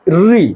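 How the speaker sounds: री said crisply with the tongue curled back; syllable demo. Shown as a demonstration. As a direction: demonstrate री with the tongue tip curled up. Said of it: "practical भी कर सकते हैं". (री को जीभ मोड़कर साफ़ बोलना)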